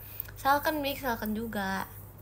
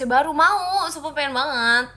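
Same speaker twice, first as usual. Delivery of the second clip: excited want; 5 semitones higher, 11 dB louder than usual. Real, not acted. Excited wish for new AC.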